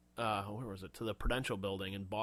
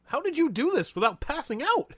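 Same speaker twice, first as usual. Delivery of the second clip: high, loud mock disbelief; incredulous outburst; 8 dB louder than usual. Viewer questioning the growling Batman, loud high disbelief. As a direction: blurt it out pitched way up.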